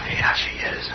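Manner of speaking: soft hushed whisper; hushed whisper while taking aim. Acted. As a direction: whisper so the outlaws can't hear. Keeping hidden near the outlaws.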